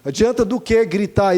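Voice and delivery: normal talking voice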